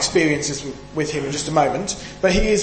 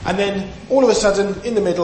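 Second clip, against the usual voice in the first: ordinary talk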